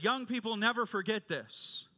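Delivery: high, loud declaration, phrase by phrase; impassioned proclamation. Retold or imitated. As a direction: proclaim scripture to the young people with full force. Quoted professor proclaims to the class.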